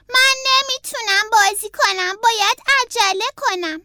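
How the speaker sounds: put-on a young turtle in a rush; hurried Franklin. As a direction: Franklin telling the fox he is in a hurry. Franklin voiced hurrying.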